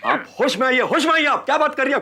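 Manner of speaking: high, sharp, indignant rebuke; angry scolding. Acted. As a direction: snap at her to come to her senses. Husband scolding wife in quarrel. (गुस्से में डाँटते हुए)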